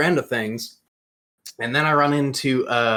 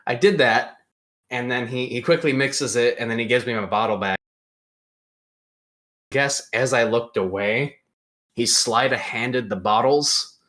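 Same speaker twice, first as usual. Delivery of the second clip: ordinary delivery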